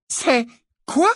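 very high, loud baffled question; stunned. A narrator's reading. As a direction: break off in astonishment. Hermione stunned by the correction.

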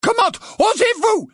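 very high indignant shout; outraged cry. A narrator's reading. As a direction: cry it out, pitch right up. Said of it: "s'écria-t-elle".

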